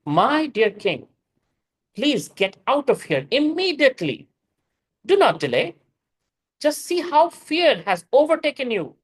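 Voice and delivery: mild urgent plea to the king; urgent warning. Acted. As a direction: urge the king to leave now. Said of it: "he will speak very harshly".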